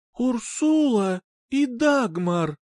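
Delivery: slow lisping call, s turned to a slushy sh. Acted. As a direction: lisp through the names, slowly. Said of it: "шепеляво, тихо произнесла она".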